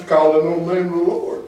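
normal talking voice

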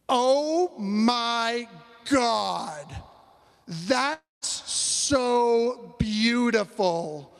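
woman gushes slowly over the video, drawn out; mock awe, stretching each word; gushing awe. Woman reacting to the video he shows her, gushing.

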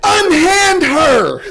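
slow, high, theatrical cry of unhand her; hammy stage actor. Pretend. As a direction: ham it up like an old melodrama hero. Mock hero rescuing a woman.

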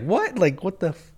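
high-pitched incredulous "what"; stunned disbelief. Retold or imitated. Fan invited into skater's video, retold high-pitched astonishment. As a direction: blurt it high, cutting off the swear. Retelling his shock at the invitation.

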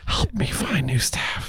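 hushed mock-desperate whisper; playful pleading whisper, drawn out; whispered plea. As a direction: whisper it like a secret cry for help. Comic plea for new staff.

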